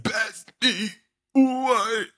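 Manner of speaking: high strained cry of disbelief; hammed-up, high-pitched dying shock; mock shocked. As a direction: cry out as if just shot. Voicing a man falling after being shot.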